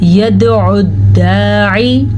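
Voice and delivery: very slow chanted recitation; Quran recitation. Shown as a demonstration. Teacher reciting the next verse for students, very slow chant. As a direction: stretch every syllable in a slow chant. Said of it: "तो भी रॉ पुर पड़ी जाएगी।".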